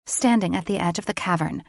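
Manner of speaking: plain reading voice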